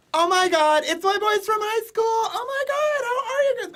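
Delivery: very high, loud campy greeting; effeminate squeal. Retold or imitated. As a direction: imitate a friend squealing over old buddies.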